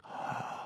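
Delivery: soft, breath-only gasp; acted shocked gasp. Man accused by girlfriend, reenacted gasp of shock. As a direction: suck in air in mock shock.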